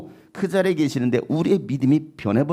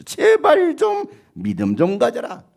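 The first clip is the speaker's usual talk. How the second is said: pleading cry; 8 semitones higher, 4 dB louder, slower than usual. Real, not acted. Pleading exhortation.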